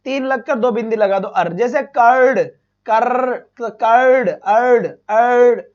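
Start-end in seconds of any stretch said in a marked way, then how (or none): 1.3-1.5 अर्र with a long rolled r-colour; ar sound demo. Shown as a demonstration. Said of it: "अर्र की sound आए तो उसका symbol". 1.5-5.6 कर्ड stretched slow and louder; slow sound demo. Shown as a demonstration. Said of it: "अर्र की sound".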